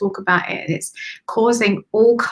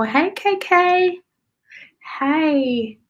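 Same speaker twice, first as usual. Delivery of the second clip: higher, slow lilting hello; higher drawn-out hey; sing-song greeting; 8 semitones higher, much slower than usual. Real, not acted. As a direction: greet a friend brightly. Greeting viewer warmly.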